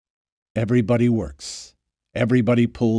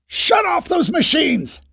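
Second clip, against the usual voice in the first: much higher, slow yell over the pumps; slower than usual. Acted. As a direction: yell over the roaring machines. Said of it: "he yelled".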